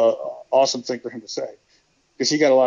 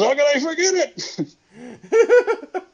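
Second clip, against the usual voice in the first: much higher, slow, laughing voice; Terry Taylor laughing; 13 semitones higher, much slower than usual. Retold or imitated. Terry reminding Bob he never wanted to win, much higher slow laughing voice. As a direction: stretch it out through a laugh. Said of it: "He goes".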